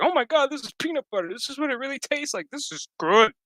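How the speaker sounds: very high, fast, amazed babble; tripping out. Retold or imitated. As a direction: gush like tasting real food for the first time. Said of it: "he's tripping the fuck out".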